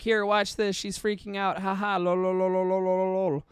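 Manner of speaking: imitating someone who finds it funny; mocking group poster. Facebook poster sharing the clip, mild mock glee. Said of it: "and they're like".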